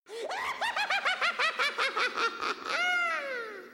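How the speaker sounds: a long, very high burst of laughter; shrieking laugh. Real, not acted. Cracking up over Benny trying not to sneeze, shrieking laugh. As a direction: howl with laughter. Cracking up at her own joke.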